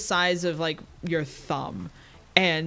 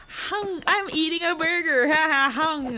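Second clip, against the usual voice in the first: mock voice; 11 semitones higher than usual. Mocking gummy burger.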